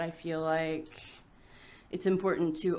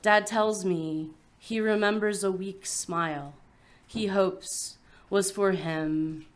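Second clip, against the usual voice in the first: solemn, measured poem recital; poetry reading; 4 dB louder than usual. Acted. Poet reading an epitaph for a dying mother, measured recital. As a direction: set down each phrase with a pause after it. Reads an elegy aloud.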